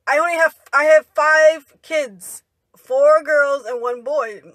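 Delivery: slow, loud retold mom's retort; imitating mom setting someone straight; emphatic correction. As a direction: spell it out firmly, slowly. Mother setting the questioner straight.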